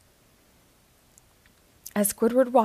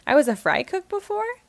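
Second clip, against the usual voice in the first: usual speaking voice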